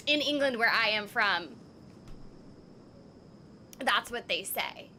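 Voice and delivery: ordinary delivery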